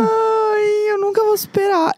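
high-pitched mock despair; dramatic high wail. Real, not acted. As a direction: whine it out dramatically.

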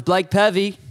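"Pavey" as PAV-ee with short a; mispronounced surname. Retold or imitated. Host closes the interview, still saying "Pavey" as PAV-ee. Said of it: "Uh, it's Pavey".

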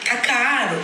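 bad Brazilian accent. Shown as a demonstration. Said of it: "vai ficar assim".

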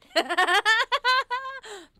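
big high cackle of laughter; loud burst of laughter. Real, not acted. Player cracks up over the odd item, loud laughter. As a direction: crack up loudly.

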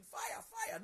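high mock cry of alarm; imitated panicked shout. Seeing flames no one else sees, she cries out high. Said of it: "I'll be shouting".

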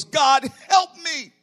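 high, drawn-out shouted plea; desperate retold prayer, stretched and shouted; crying out to God. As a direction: cry out loudly to God for help. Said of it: "I cried out to God".

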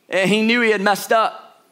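ordinary talk

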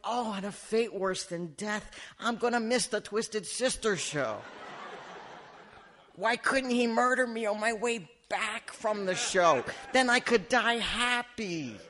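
high, melodramatic wail; mock despair. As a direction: lament it like a hammed-up tragedy. Mock despair at missing the show.

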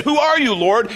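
high, loud cry up to the voice overhead; startled questioning. Acted. Saul asks the voice from heaven who it is, startled. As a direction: call it out high, rising at the end.

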